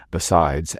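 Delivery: ordinary delivery, nothing marked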